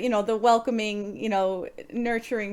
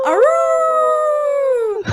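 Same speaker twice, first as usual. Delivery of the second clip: loud, high sustained wolf howl; werewolf howl; 10 dB louder than usual. Pretend. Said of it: "All right, ready?".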